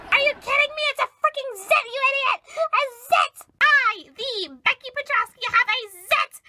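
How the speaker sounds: loud, high-pitched outrage; horrified outburst. Acted. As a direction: exclaim it in dramatic horror over a zit. Acted horror at finding a zit.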